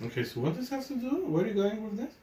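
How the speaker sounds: high, fast, quiet; impatient. Real, not acted. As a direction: hurry them to the point. Impatient question to friend.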